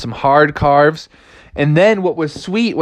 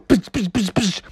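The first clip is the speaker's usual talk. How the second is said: quick hissed "psh" impacts; karate chop noises; 6 semitones higher, 5 dB quieter than usual. Acted. Frog chopping shark's face, imitated blows. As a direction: mouth the sound of rapid chops.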